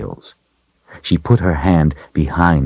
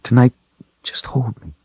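tearful whisper; 9 semitones higher than usual. A narrator's reading. Tearful female plea, whispered.

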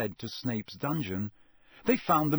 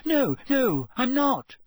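high, louder hasty denial; flustered, high protest; 12 semitones higher, 5 dB louder, slower than usual. A narrator's reading. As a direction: deny it at once, pitched high. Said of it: "she said hastily".

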